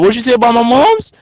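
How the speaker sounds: loud, high, offended retort; a hammed-up touchy guy taking offense; angry mock challenge. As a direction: bark it back like you're ready to fight. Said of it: "they'd be like".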